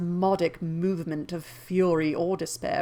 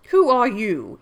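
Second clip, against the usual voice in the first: forceful demand; 4 semitones higher, 9 dB louder than usual. Acted. Rochester demands of intruder.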